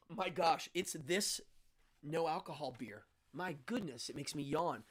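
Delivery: ordinary delivery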